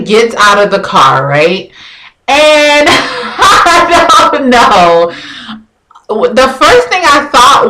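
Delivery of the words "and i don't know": high, drawn-out laughter through the words; laughing. Real, not acted. Storyteller recalling her date stepping out, cracking up. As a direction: crack up mid-sentence, slowly getting it out. Cracks up before describing his looks.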